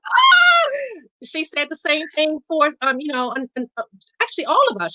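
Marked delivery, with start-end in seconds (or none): 0.0-0.7 sudden high yelp of alarm; a half-joking shriek; horrified yelp. Retold or imitated. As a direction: squeal in mock horror at the goal. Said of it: "I'm like".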